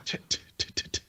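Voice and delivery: whispered clicking keyboard noises; pecking keyboard sound. Retold or imitated. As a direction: tap out keystrokes with your tongue. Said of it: "He's like".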